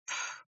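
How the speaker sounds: soft scornful puff of air; dismissive pfff. Acted. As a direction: blow it out, unimpressed. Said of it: "fit la vieille femme".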